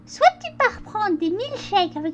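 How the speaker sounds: mock stern mother; mother's threat. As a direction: threaten to confiscate his games.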